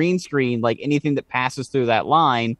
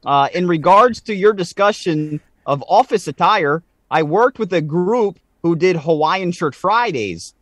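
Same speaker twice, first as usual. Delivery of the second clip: put-on light Canadian accent, a bit higher; 4 semitones higher than usual. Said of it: "I'm gonna read this in a Canadian accent".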